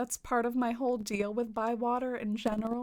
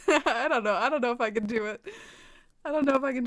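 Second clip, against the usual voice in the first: laughing; 6 dB louder, faster than usual. Real, not acted. Laughing after trying the accent.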